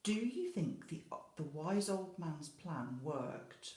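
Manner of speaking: plain reading voice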